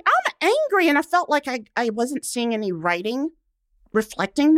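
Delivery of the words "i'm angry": mock declaration. Retold own feeling.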